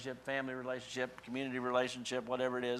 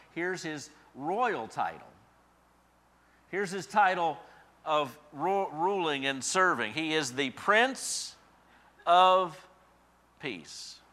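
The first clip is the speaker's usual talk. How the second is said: slow, louder build to Prince of Peace; grand announcement; 5 semitones higher, 8 dB louder, much slower than usual. Acted. Preacher unveiling Christ's title, slow and grand. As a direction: announce the royal title slowly.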